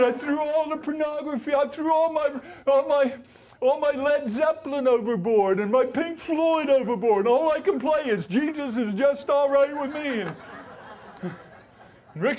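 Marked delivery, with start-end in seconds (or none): 0.0-10.2 high, loud, laughing confession; gleeful. Retold or imitated. Laughing as he tells Rick he threw it overboard. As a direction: proudly blurt it all out.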